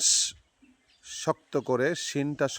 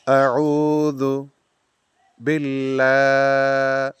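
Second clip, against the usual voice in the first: very slow recitation, phrase by phrase with long pauses; broken into parts; 5 dB louder, much slower than usual. Shown as a demonstration. Teacher breaking it up for students to follow, very slowly. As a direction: recite it piece by piece, stretching each word. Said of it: "ভেঙে ভেঙে বলুন".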